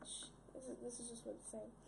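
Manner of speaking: hushed aside; whisper. Real, not acted. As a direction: whisper so nobody hears. Hushing while scheming.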